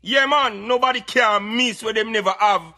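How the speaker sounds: ordinary delivery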